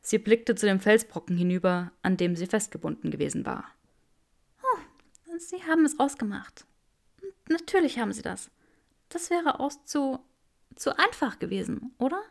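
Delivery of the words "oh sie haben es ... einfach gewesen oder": higher, softer, dazed voice; dazed woman. A narrator's reading. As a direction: sound dazed and weak.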